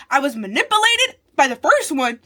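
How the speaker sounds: loud protest peaking on "manipulated"; emphatic outburst. Real, not acted. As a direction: exclaim it, hitting "manipulated" loudly. First take of an emphatic repeated claim.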